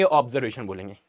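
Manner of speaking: ordinary delivery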